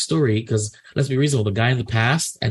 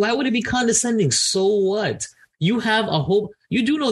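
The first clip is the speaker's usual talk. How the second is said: indignant; 9 semitones higher than usual. Real, not acted. Indignant rant about condescension.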